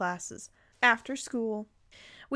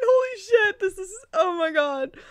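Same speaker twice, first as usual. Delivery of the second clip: overwhelmed; 9 semitones higher, 5 dB louder than usual. Real, not acted. Overwhelmed after the screaming.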